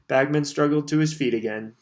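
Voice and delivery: ordinary delivery, nothing marked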